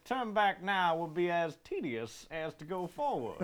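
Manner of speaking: hammed-up Southern declaiming, slowing toward the end; Southern Shakespeare. Performing a Shakespeare speech for friends, theatrical Southern accent. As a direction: recite Shakespeare in a big Southern drawl. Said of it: "I want to do a Shakespeare play".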